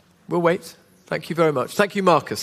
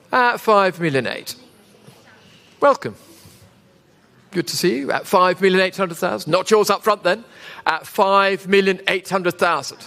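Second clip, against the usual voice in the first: auctioneer; 3 semitones lower than usual. Real, not acted. Live auction bid calling.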